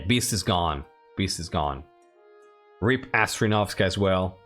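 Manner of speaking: ordinary talk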